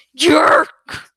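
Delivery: a scream ringing inside a vision; echoing cry. A narrator's reading.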